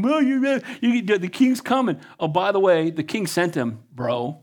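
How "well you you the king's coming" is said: mocking. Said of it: "They're mocking".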